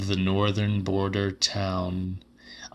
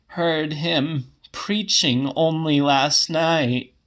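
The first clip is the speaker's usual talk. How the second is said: put-on raised, slow old woman's voice, gently insistent; an old woman explaining the vanished preacher, a little higher; 7 semitones higher, slower than usual. A narrator's reading. Old woman correcting the man who insulted her, voice pitched higher.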